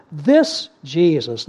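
"This" punched and held, then "Jesus"; slow, weighty stress on "This Jesus"; emphatic. Shown as a demonstration. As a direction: lean hard on "This", set "Jesus" apart. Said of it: "notice the emphasis on that".

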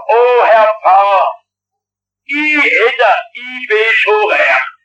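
put-on a light Swabian accent, slow and self-satisfied; Swabian parishioner. A parishioner tells the pastor in a mild Swabian accent. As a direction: play the smug Swabian villager, lightly in Swabian dialect. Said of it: "Das ist so schwäbische Weltanschauung".

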